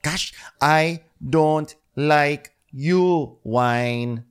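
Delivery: slow, high, mock-frustrated cursing; Luke. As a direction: scold the spill word by drawn-out word. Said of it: "being Luke about it".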